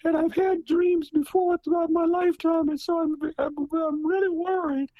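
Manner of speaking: high worried mother telling her son; dramatized mother. Retold or imitated. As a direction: voice the frightened mother describing her dream. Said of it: "pardon my, uh, characterization".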